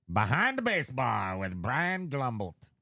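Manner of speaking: slow, drawn-out title announcement; mock sports-show opener; show announcer. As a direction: stretch it out like a show intro.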